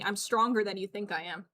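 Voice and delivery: ordinary talk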